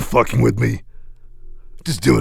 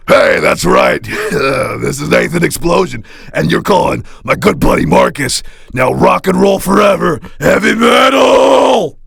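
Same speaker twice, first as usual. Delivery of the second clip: metal star voicemail; 4 semitones higher than usual. Acted. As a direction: bellow it like a metal frontman. Recording metal star voicemail greeting.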